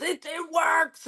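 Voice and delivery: high, drawn-out mock protest; protesting robber. Robber defending his broken gun to her, mock protest. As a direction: play the robber insisting, slow and high.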